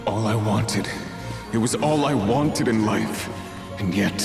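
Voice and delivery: very low, loud anguish; pained voice. Acted. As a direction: speak as if the memories are too hard to bear. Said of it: "He sounds like he's in pain".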